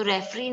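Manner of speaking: usual speaking voice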